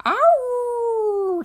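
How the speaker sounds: high, loud mournful dog howl. A narrator's reading. Dog howling outside the church during the sermon. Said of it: "said Winn-Dixie".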